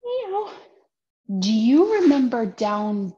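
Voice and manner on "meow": high cute meow; kitty meow. Pretend. Teacher meowing for kitty cat pose. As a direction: squeak it like a kitten. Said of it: "Kitty cat pose?".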